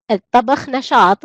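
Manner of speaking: normal talking voice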